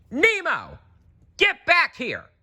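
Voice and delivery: high desperate call; frantic shout. Acted. Marlin shouting for Nemo to come back. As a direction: shout after him in panic. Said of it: "Marlin shouted".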